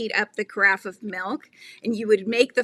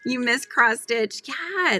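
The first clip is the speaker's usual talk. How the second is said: normal talking voice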